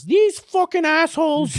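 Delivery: high, slow, hammed-up Irish accent; mock Irish YouTuber. Imagining an Irish YouTuber reacting in a mock Irish voice. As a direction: squeal it like an outraged Irish vlogger. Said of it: "Jacksepticeye's gonna make a video".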